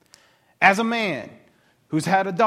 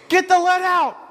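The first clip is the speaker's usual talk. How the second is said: high, loud hammed-up hurry-up shout; mock impatient yell; 12 semitones higher, 6 dB louder than usual. Jairus hollering at Jesus to hurry up, loud and high.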